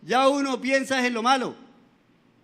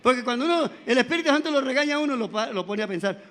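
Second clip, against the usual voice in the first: normal talking voice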